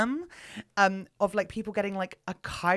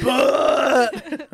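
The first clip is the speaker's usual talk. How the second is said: loud "But."; 4 semitones higher, 10 dB louder than usual. Shown as a demonstration. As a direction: blurt it out loudly. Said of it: "just say it louder".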